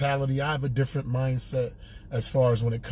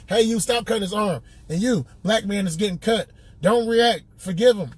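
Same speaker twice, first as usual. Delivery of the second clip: put-on higher, louder hillbilly accent; Gary Owen hillbilly voice; 6 semitones higher, 3 dB louder than usual. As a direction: preach it like a country hillbilly. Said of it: "making Gary sound like a hillbilly".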